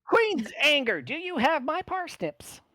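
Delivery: very high, loud squeaky demand; angry gnome chef. Acted. Gnome chef to Birchstorm, high shrill demand. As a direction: shriek it like a frantic little chef.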